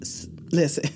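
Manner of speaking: breathy hushed listen; hushed before opening up; whispered listen. Real, not acted. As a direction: lean in and whisper it.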